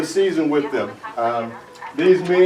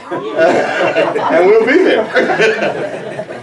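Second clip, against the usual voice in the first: laughing; 2 semitones higher, much slower than usual. Real, not acted. Laughing while signing off.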